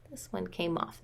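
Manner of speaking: usual speaking voice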